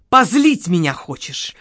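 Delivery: ordinary delivery, nothing marked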